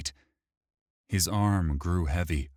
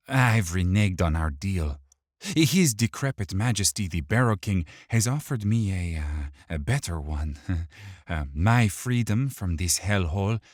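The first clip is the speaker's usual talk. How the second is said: sneering, mocking villain; 2 semitones higher, 4 dB louder than usual. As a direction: taunt him with a smirk. Dirge voiced.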